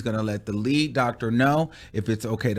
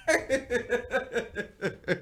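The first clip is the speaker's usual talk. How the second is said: high, helpless giggling fit; real laughter; 10 semitones higher, 7 dB quieter than usual. Real, not acted. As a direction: crack up in high bursts. Cracks up at own story.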